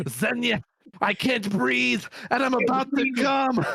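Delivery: high, strained, slow gasping voice; dying admiral. Retold or imitated. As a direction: gasp it out like a dying man. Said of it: "I grossly quoted the dying admiral".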